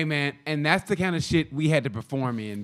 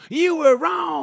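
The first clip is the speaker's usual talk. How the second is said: high, loud mock jeer; heckling crowd; 14 semitones higher, 7 dB louder, slower than usual. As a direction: cry out like a scolding audience. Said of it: "And they go".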